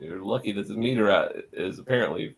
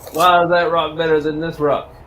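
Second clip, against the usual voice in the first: loud, higher giant voice; 5 semitones higher, 8 dB louder than usual. Acted. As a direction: boom it out as a curious giant.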